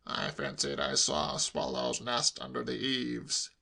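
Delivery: put-on low, slow older-relative voice; evasive uncle. A narrator's reading. Uncle inventing an excuse for nephew, evasive. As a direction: offer the excuse slowly and low.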